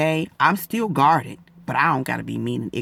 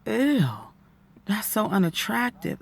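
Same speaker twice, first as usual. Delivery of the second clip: disgusted; 7 semitones higher than usual. Retold or imitated. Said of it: "And I was like".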